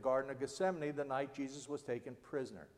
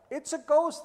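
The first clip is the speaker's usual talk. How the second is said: higher, louder frightened cry; cried out in fear; 11 semitones higher, 8 dB louder than usual. Acted. Disciples seeing Jesus on the lake, frightened cry. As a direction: shout it out startled by a ghost. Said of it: "they said, and cried out in fear".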